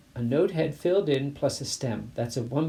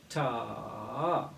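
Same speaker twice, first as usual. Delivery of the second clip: ordinary talk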